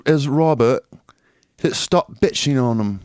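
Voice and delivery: slow, mock-regal British accent. Shown as a demonstration. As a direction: finish slowly in a royal British accent. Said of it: "Do a regal, uh, British accent".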